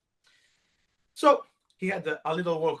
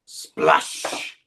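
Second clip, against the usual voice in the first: splash; 12 semitones lower, 4 dB louder than usual. Acted. Blue dot lands.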